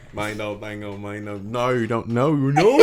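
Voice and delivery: low, mumbled Southern practice; garbled accent attempt. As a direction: fumble toward a Southern "No". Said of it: "I'm gonna start talking in a Southern accent".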